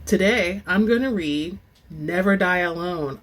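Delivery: usual speaking voice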